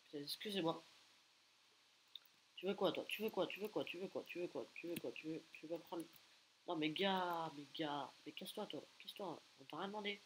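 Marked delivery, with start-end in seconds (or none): none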